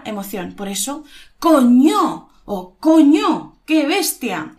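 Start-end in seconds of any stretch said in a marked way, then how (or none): 1.4-4.5 COÑO stretched slowly and punched loud; emphatic. Shown as a demonstration. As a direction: draw out "coño" with great emphasis. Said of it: "expresar con bastante énfasis".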